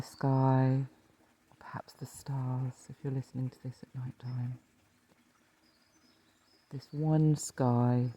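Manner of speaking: calm meditation teacher, leaving space to breathe; guided meditation. Acted. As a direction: lead listeners gently into rest, phrase by phrase.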